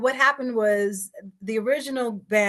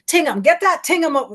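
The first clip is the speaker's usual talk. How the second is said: higher, quick Bahamian "Tingham"; Bahamian accent; 6 semitones higher, 5 dB louder than usual. Shown as a demonstration. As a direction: say it in a Bahamian accent, a bit too fast. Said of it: "I'm still doing it too fast".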